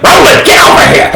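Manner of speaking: hammed-up shout for an assistant; barking summons. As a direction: yell across the room as a bossy wizard. Wizard summons his assistant.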